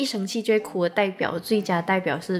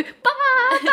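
put-on much higher, louder toddler calling for her father; little girl calling daddy; 5 dB louder than usual. Imitating the niece calling her dad back from the toilet, a high, loud toddler cry. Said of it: "也会".

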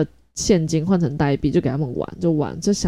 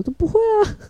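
put-on high, carefree child voice; little boy's voice. Son telling mom he isn't shy, in a high child voice. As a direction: squeak it out like an unbothered little boy.

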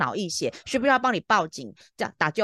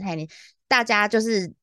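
normal talking voice

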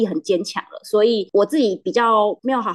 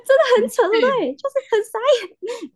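much higher, laughing amazement; amused disbelief; 3 dB louder than usual. Real, not acted. Agent telling host the twist, amused disbelief. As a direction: marvel at how absurd it was.

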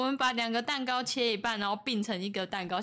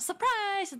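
high, drawn-out "surprise"; playful high-pitched reveal; acted surprise cheer; 10 semitones higher than usual. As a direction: call it out like springing a party. Acts out planned surprise shout.

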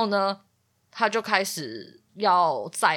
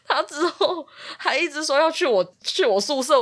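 laughing; 9 semitones higher, 4 dB louder than usual. Real, not acted. Laughing through the story.

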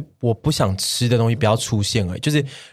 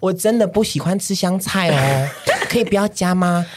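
sweet cutesy request; 5 semitones higher than usual. Retold or imitated. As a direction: coax it out sweetly. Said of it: "用你这个语气跟老板讲话".

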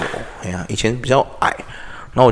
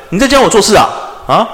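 domineering ceo; 8 semitones higher, 5 dB louder than usual. Retold or imitated. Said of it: "学那个霸道总裁回他".

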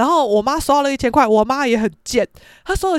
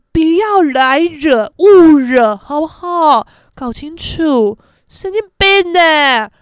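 raised, drawn-out mock threats with loud bursts; hammed-up street-tough rant; tough gangster girl; 4 semitones higher, 5 dB louder than usual. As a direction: warn them off like a swaggering delinquent girl. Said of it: "我就是八加九小太妹".

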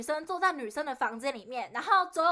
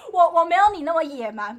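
bursting into laughter; 7 semitones higher, 11 dB louder than usual. Real, not acted. Teasing friend about past tantrum.

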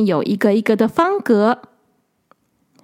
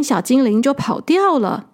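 ordinary delivery

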